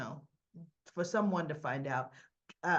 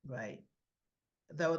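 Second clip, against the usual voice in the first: normal talking voice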